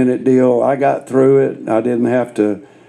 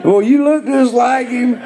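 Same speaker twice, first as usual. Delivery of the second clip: high, overly friendly mock greeting; hammed-up buddy hello; 13 semitones higher than usual. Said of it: "I said".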